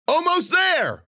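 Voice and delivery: high, loud straining shout. A narrator's reading. Rufus urging everyone on while jumping, shouting. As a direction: shout it mid-jump.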